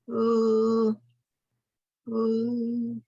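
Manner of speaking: Ooh sustained with the tongue tip up; long steady L sound, held twice. Shown as a demonstration. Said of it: "So that sound sounds like".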